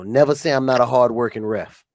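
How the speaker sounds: usual speaking voice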